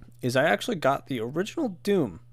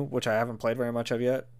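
usual speaking voice

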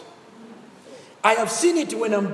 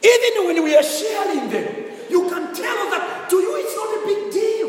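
preaching; 6 semitones higher, 4 dB louder than usual. Real, not acted. Preaching at full force.

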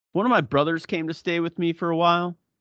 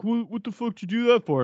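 angry brother; 8 semitones higher than usual. Retold or imitated. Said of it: "He's like".

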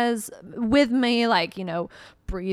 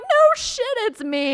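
frantic retort; 12 semitones higher than usual. Retold or imitated. Telling passersby it's her, high and frantic. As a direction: fire it back, half panicked, half laughing. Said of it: "And I'm like".

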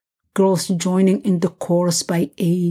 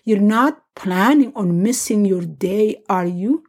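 ordinary delivery

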